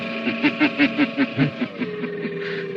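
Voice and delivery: quiet, distant mocking laugh; mocking hero laugh. Shadow revealing himself to the doctor, mocking.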